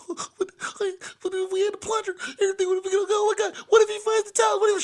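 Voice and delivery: imitating a panicking little brother; panicked whimper. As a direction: fall apart, nearly crying. Cavell panicking, near crying.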